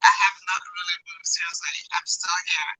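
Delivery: hollow far-off phone sound; echoing tunnel. Real, not acted. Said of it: "You sound like you're in a tunnel".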